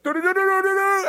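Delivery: high, loud sung-out trumpet imitation; mouth-made alarm trumpet, held high; imitated horn call. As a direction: toot out a warning horn on "do-do-do". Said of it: "I don't know what it sounded like".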